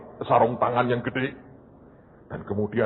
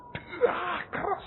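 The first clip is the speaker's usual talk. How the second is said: much higher, slow strained squeak; squeaky pained gasp; 6 dB quieter, much slower than usual. Acted. Ball squeaking after a hard hit, slow and strained. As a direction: squeeze it out in pain.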